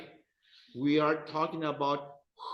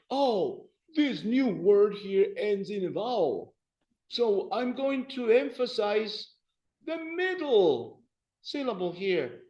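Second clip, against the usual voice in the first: high, eager voice working out a rule; mistaken learner; 7 semitones higher, 4 dB louder than usual. Acted. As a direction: play a student reasoning aloud, pitched up. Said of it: "You would say".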